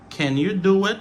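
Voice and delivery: careful, unreduced "Can"; full pronunciation. Shown as a demonstration. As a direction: articulate "Can" clearly in the question. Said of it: "c'est prononciation normale".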